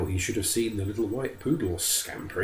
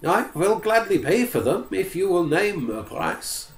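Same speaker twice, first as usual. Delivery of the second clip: higher, humble and polite; pleading forester; 10 semitones higher than usual. Acted. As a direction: offer to pay, anxious to please.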